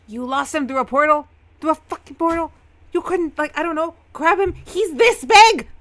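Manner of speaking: loud, high, disbelieving yell; angry tongue-lashing. Acted. Samuel scolding Eamon over the lost Arden, incredulous yelling. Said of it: "like a lashing".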